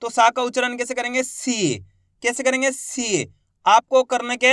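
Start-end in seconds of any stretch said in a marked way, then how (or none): 1.2-1.8 "सी" with the long ee held; drawn-out vowel. Shown as a demonstration. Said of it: "खींच खींच के इसका उच्चारण करेंगे". 2.7-3.3 "सी" with the long ee held; drawn-out vowel. Shown as a demonstration. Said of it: "खींच खींच के इसका उच्चारण करेंगे".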